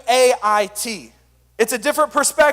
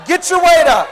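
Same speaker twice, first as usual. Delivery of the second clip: full shout from the chest, higher; hype-man shout leading the crowd; say it with your chest; 5 semitones higher, 6 dB louder than usual. Shown as a demonstration. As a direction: shout it like a rallying cry. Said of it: "Y'all gotta say it with your chest".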